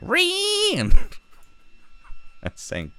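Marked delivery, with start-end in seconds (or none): 0.0-0.7 very high screeching "Ree!"; mock "ree" screech. Imitating the Pepe meme, high screech.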